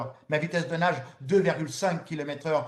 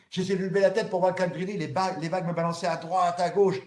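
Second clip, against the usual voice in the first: usual speaking voice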